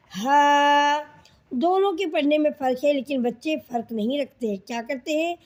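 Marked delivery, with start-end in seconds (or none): 0.1-1.0 ہا breathed out from the chest; airy open ہا; light ha. Shown as a demonstration. Teacher contrasting the second ha sound. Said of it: "اسی آواز میں ہے".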